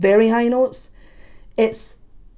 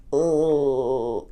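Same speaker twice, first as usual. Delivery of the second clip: groan; 7 semitones lower than usual. Retold or imitated. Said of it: "I might be a little bit like".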